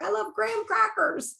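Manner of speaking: high, gushing mock client. Client telling her coach what she loves, in a high gushing mock voice. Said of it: "they're like".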